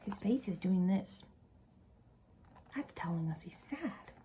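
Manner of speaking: soft whispered explanation; hushed, confiding whisper. Real, not acted. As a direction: whisper it gently to the little ones.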